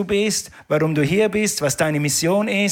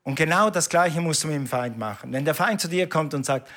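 ordinary talk

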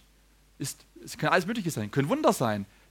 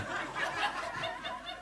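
breathy laugh; 11 dB quieter than usual. Real, not acted. Laughs at his own thought.

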